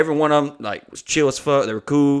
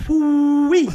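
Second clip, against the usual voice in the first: mock amazement; 14 semitones higher, 4 dB louder than usual. Reacting to the owner's boast.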